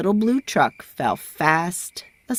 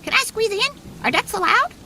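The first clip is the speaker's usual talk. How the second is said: very high, airy duck voice; squeaky duck; faster than usual. A narrator's reading. Duck asks to squeeze into the crowded garage, very high. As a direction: squeeze the words out like a tiny duck. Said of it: "said Duck".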